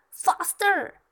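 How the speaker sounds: high, loud stepsister's command; shouted order. A narrator's reading. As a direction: shout it at a servant. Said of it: "shouted one stepsister".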